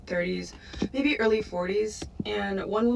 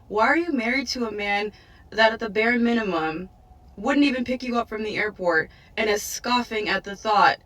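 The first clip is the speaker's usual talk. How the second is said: ordinary talk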